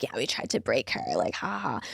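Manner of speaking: low mocking sneer with fake laugh; mocking imitation. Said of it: "laughing and saying how they tried to break me".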